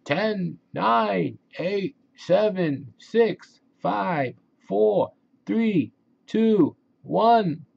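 high, loud rising countdown to blast off; shouted countdown. Acted. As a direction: yell it like launch control. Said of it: "shouts Ralph".

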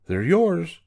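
high, generous call. A narrator's reading. Woodpecker offering holes to the squirrel, calling out. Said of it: "called the woodpecker".

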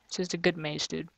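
low-key, quiet sarcasm; mumble-soft deadpan praise you don't mean; sarcastic. Real, not acted. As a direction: say it softly, insincerely. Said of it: "I'm being sarcastic".

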